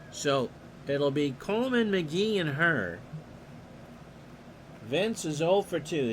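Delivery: steady announcer call of the action; play-by-play. Acted. Announcer calling the pitch to the listeners, play-by-play. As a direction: call the game like a radio announcer.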